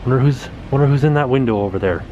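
normal talking voice

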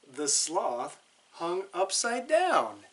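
ordinary delivery

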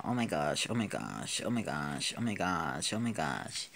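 deep quiet toy voice, awed; low small voice. Acted. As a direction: mutter it low in awe. Another figure reacting, repeated.

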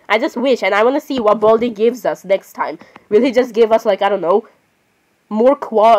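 ordinary delivery

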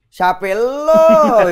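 mock retort. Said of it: "Gitu kan".

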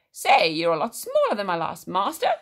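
loud, brash, swooping genie voice. A narrator's reading. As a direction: boom it out with comic swoops. Voicing the magical genie.

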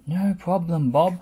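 bright, loud digger voice; chugging Scoop. A narrator's reading. To Bob, Scoop chugs back cheerfully. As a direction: chug it out eagerly. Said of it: "chugged Scoop".

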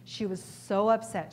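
ordinary delivery

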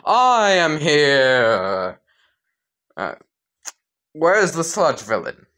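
booming, drawn-out heroic entrance; All Might. Acted. Hero bursting from the sewer to save Deku, loud heroic proclamation. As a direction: announce yourself loudly like a triumphant hero. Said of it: "All Might would burst from the sewer grate like".

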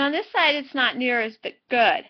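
lips stretched by fingers; speaking with mouth pulled open; fingers pulling lips. Real, not acted. Seen 0: Fingers stretch lip corners apart from 0.8s, showing braces.

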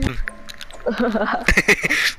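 risa aguda y entrecortada; reírse con ganas del compañero; risa divertida. Real, not acted. Se ríe de la queja del otro. (carcajada divertida)

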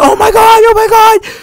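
very high squealing oh my God; excited squeal. Retold or imitated. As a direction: squeal like the crowd losing it. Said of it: "everybody's like".

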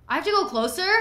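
high, loud panicked question; scared protest. Real, not acted. Gamer dreading walking closer to the body, panicked. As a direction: whine it out, terrified to go on.

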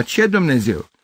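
high-pitched annoyed exclamation; irritated outcry; exasperated shout. A narrator's reading. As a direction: cry out, fed up with the noise. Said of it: "strigă cineva".

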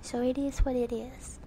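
breathy unvoiced whisper. Real, not acted. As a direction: whisper it as an aside. Whispered aside.

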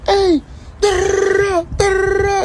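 high squeaky panicking imitation; mock panicked voice. Replayed mock of panicking friend.

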